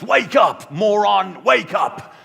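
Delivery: loud high yell, imitating her; screaming. Retelling the woman screaming in his face, loud and high. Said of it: "screamed in my face".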